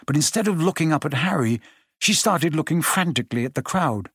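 usual speaking voice

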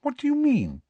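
Hermione voice. A narrator's reading.

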